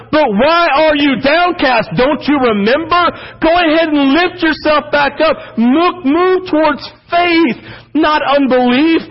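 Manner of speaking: rousing push to move toward faith; exhorting. Acted. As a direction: lift them back up toward faith. Preacher exhorting the listeners.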